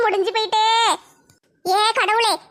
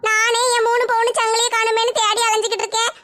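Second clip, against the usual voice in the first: usual speaking voice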